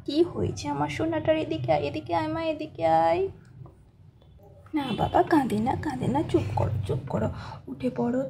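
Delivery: calm her down like a gentle dad; soothing father. Acted.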